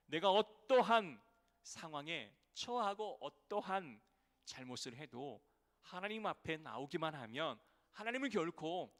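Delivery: loud, slow, high preaching; preaching at full force. Acted. Pastor pleading with the church, loud and slow. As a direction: drive it home loudly.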